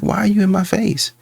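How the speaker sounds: woman at a bar tells a man to back off, annoyed; mock annoyed woman. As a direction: play the woman shoving a creep off. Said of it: "she's like".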